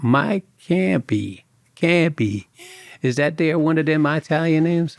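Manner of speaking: put-on light Kentucky accent, drawn-out name. Bank investigator greeting the new agent in a lightly done Kentucky accent. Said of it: "with a Kentucky accent".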